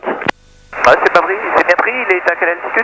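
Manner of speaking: tinny radio voice; over a pilot headset. Real, not acted. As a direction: speak through a crackly headset.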